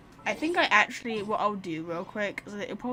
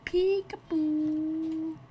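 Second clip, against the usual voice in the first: playful peekaboo; 6 semitones higher, much slower than usual. Pretend. Peeking through a gap.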